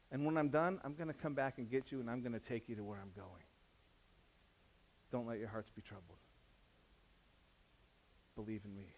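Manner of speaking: soft, fading tender promise; gentle Jesus. Retold or imitated. Jesus promises to return, soft and tender. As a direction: reassure softly, almost hushed. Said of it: "Jesus reassures".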